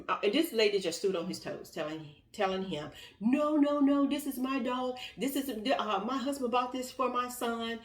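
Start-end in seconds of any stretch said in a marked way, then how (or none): 3.2-7.8 stubborn denial. Retold or imitated. Mother refuses to give dog.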